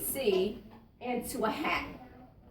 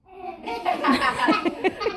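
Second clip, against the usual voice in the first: loud, high group laughter; children putting on a big laugh; laughing on request; 5 semitones higher, 6 dB louder than usual. Pretend. Class acts out the students laughing at Grace, loud mock laughter. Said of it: "Let me hear your laugh".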